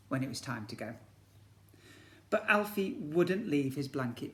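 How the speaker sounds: lively picture-book storytelling; story narration. A narrator's reading. Teacher reads to year one in storybook narration. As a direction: read it to the class like a storybook.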